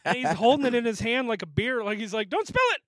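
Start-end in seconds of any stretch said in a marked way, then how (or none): 2.3-2.8 high-pitched comic warning; mock alarm. Friend holding laptop like a beer, mock alarm. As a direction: imitate a frantic warning cry.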